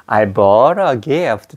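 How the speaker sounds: I bought a gift very slowly, bought a run into bora; slow model. Shown as a demonstration. Teacher models I bought a gift, very slowly. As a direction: drag it out, linking bought a. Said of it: "근데 만약에".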